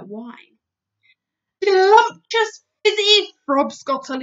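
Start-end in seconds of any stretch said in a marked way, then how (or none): 1.6-4.2 loud, higher delighted giant shout; delighted booming giant shout. Acted. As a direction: shout it with glee. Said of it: "he shouted".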